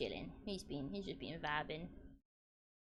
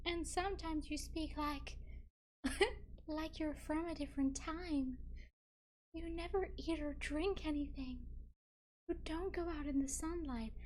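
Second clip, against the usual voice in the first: high-pitched melodramatic heroine; dramatic reading; 8 semitones higher than usual. Acted. Streamer reading Twilight heroine questioning him, high and dramatic. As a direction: play the heroine high, breaking into giggles. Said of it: "that is a dramatic reading".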